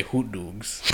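"hoot doogs" with long oo vowels in both words; Chicago pronunciation. Shown as a demonstration. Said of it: "we say".

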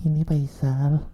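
middle-aged Faisal. Pretend. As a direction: settle into a tired grown-up tone. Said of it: "Faisal middle". (suara Faisal paruh baya yang pelan)

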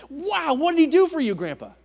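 put-on high, loud, excited kid voice; a child bursting with wonder; excited grandchild. Grandchild eagerly asking what Jesus did for grandpa, high and excited.

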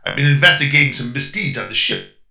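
put-on a light New York detective accent; lightly voiced tough-guy cop; gangster accent. Robot posing as detective to the crew, light New York accent. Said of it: "talking in a strange accent".

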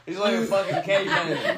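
laughing. Real, not acted. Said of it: "[name]'s sexy voice".

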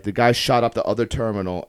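usual speaking voice

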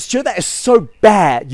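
retold doctor's telling-off; scolding doctor. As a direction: scold like a dismayed doctor, leaning on SO BAD. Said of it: "so he goes".

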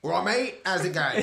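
loud, high Cockney greeting; hammed-up Cockney, belted out; Cockney accent. Shown as a demonstration. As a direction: put on a big, matey Cockney accent. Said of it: "a Cockney accent?".

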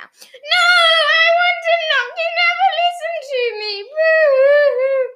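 very high, loud mock-crying child; tantrum wail. A narrator's reading. Michael refused his turn at knocking, loud high tantrum wail. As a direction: throw a wailing, hammed-up tantrum. Small boy's tantrum at parents.

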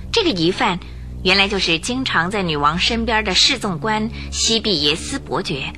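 ordinary delivery

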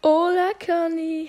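high, sing-song diva greeting; Lola voice line. Retold or imitated. Copying Lola's greeting for viewers, high and showy. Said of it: "Und jetzt Lola".

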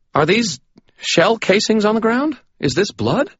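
high, fast, alarmed little man; sputtering Butters. Acted. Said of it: "sputtered a little man".

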